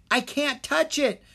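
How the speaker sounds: panicked cry from high up; desperate cry. A narrator's reading. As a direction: cry out that you can't. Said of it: "Drake cried".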